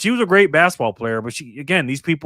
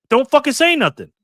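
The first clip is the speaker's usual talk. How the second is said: higher, heated rant; angry outburst; 8 semitones higher than usual. Real, not acted. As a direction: snap it at her, fed up. Ranting angrily at coach.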